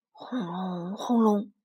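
drawn-out booming earthquake noise; imitated rumble. A narrator's reading. Narrator voicing the earthquake shaking the island, rumbling. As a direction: roll the syllables like thunder.

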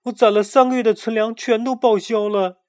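ordinary delivery, nothing marked

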